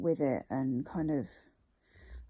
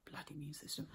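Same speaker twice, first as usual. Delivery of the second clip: muttered whisper; 3 semitones lower, 17 dB quieter, faster than usual. Real, not acted. Muttered complaint about illness.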